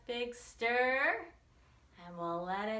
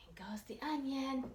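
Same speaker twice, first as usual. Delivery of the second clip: hushed whisper over the pot; 6 semitones higher than usual. Acted. Teacher whispering the ingredient to the children. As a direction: whisper it like a secret.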